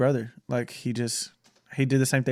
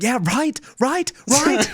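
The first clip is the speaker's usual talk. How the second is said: much higher, louder mock "yeah right"; squeaky overdone sarcasm; sarcastic agreement; 12 semitones higher, 5 dB louder, much slower than usual. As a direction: ham up the fake agreement. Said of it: "I'm like".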